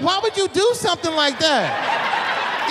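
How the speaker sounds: slow, drawn-out outraged question; hammed-up disgusted coworker; mock indignation. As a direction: stretch it out like you can't believe it. Angry coworker quoted.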